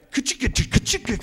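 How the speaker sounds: rapid percussive ka-chicka noise; imitated clatter of hits. Speaker imitating eggs hitting a bus, rattling. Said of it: "and I hear".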